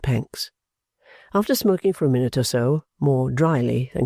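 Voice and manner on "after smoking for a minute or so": ordinary delivery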